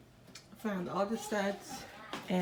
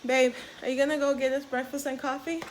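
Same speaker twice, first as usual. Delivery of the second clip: louder, higher call across the house; 5 semitones higher, 6 dB louder than usual. Real, not acted. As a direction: call out to Rob in the other room. Calling out to husband.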